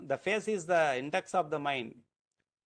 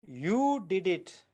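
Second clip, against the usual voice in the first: YOU stretched long and raised; stress on YOU; 2 semitones higher, slower than usual. Shown as a demonstration. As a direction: slowly lean on the word "you". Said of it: "emphasis that I am giving".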